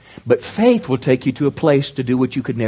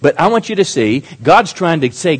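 ordinary delivery

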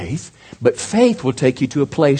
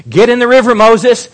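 higher, louder call from God; God commanding; 9 semitones higher, 7 dB louder than usual. Acted. God calling Moses into the river, loud command. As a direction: urge him loudly into the river.

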